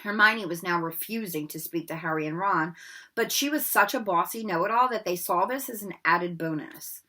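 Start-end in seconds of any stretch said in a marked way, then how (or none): none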